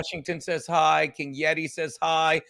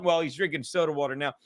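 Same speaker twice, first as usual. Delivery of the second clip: ordinary delivery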